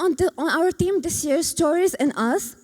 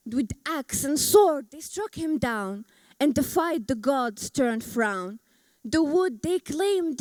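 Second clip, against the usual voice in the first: measured epic verse, lines set apart by pauses; poem recital. Acted. Speaker presenting own Gilgamesh poem to an audience, solemn verse recital. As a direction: recite it like an ancient epic, drawing out key words.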